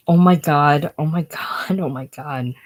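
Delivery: overwrought, loud start then sinking; desperate, fading lament; distraught.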